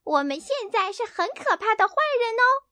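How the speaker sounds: high, gleeful kitten voice; a kitten bragging it is scary; playful kitten. Acted. Kitten telling Hena they are baddies, high and gleeful.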